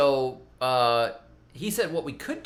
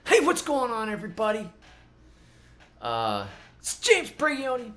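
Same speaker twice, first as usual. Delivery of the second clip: much higher, louder, overly excited YouTuber voice; James Brigioni impression; 7 semitones higher, 5 dB louder than usual. Parodying an overexcited YouTube gardener, hyped greeting. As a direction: gush like a hyped gardening channel host. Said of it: "that other YouTube gardener who's overly excited".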